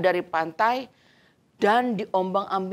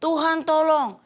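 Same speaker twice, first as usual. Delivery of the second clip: crying for help; 7 semitones higher than usual. Acted. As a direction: shout for rescue while sinking. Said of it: "Lalu berteriak". (teriakan minta tolong bernada tinggi)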